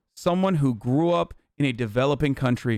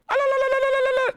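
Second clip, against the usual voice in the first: mock war cry; faster than usual. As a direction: trill a piercing high la la la like a mock battle cry. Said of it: "if you're going".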